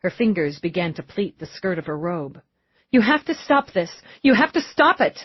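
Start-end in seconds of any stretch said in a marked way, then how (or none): 2.9-5.2 high, agitated pleading; desperate plea. Acted. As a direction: beg them urgently to stop. Cornered mother begs police to stop.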